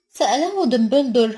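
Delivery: ordinary delivery, nothing marked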